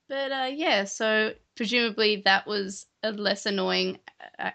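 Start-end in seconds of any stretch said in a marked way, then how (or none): none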